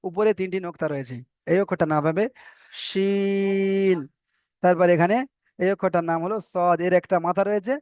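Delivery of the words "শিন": শিন held slowly with a long vowel; শিন recited slowly; drawn-out Shin. Shown as a demonstration. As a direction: stretch শিন out. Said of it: "letter name drawn out".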